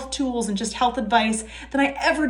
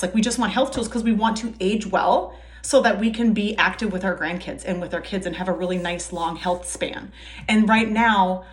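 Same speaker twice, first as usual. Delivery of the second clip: ordinary talk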